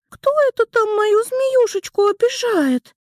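put-on high little girl voice; squeaky, playful small girl; Mora's voice. Mora speaks, higher voice.

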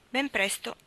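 ordinary delivery

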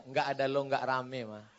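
low, soft aside to the wife; husband placating wife. Acted. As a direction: say it low and soft, as sweet-talk. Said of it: "bilang sama dia". (suami membujuk istri dengan suara pelan)

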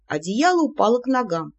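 usual speaking voice

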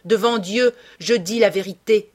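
loud, impassioned oath; exclaiming. A narrator's reading. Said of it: "s'écria-t-elle".

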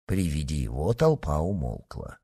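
ordinary delivery, nothing marked

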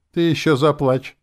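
high, loud mocking jeer; snide demon girl. A narrator's reading. Demon Nastya mocks the hero's regrets, snide. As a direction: taunt him in a high, needling voice. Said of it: "ехидно проговорила Настя".

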